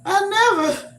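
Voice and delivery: high, loud mock-offended gasp; high, loud mock-indignant exclamation to the teasing grandkids. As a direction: play the scandalized grandma. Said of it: "and I said".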